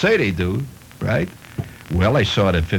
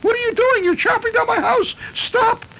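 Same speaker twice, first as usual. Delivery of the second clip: piercing falsetto shriek, far higher; woman's shrieking cry. Acted. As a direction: shriek like a woman watching her house wrecked. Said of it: "she cried".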